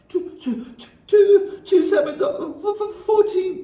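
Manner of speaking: very high, stuttering gasps; gasped in terror. Acted. Terrified boy gasping out the answer, very high. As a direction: stammer it out in panic. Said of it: "gasped Rupert".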